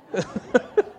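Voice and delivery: breaks into a high laugh at his own joke. Real, not acted. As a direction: genuine burst of laughter. Cracks himself up mid-sermon.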